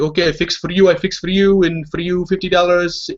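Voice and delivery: high, eager mock French African accent; poor French African accent. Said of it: "French African accent".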